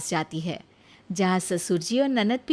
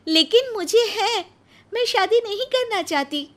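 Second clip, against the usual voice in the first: much higher, louder firm refusal; refusing Nisha; 13 semitones higher, 5 dB louder than usual. Acted. Nisha refusing the proposal, firm high refusal. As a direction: shut the idea down.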